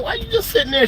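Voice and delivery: high-pitched incredulous question; retold outrage, voice shooting way up; incredulous scolding. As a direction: squeak it out in mock disbelief. Retelling his shocked scolding of wife.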